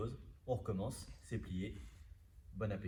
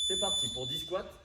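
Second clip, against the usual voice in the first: wolfing down food; 6 semitones higher, 8 dB louder than usual. Real, not acted. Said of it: "Je m'excuse de parler la bouche pleine".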